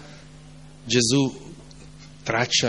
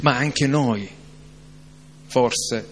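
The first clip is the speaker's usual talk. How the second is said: ordinary delivery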